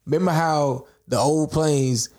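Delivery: normal talking voice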